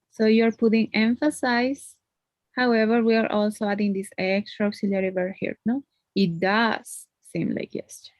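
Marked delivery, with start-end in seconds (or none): 6.1-8.1 DOES held long and stressed; emphasis on DOES. Shown as a demonstration. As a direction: stretch out the word does. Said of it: "you're emphasizing that".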